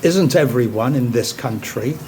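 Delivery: usual speaking voice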